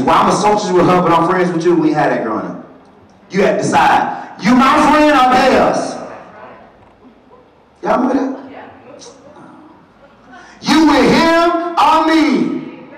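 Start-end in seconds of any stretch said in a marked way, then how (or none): 4.4-12.9 put-on childish friend demand.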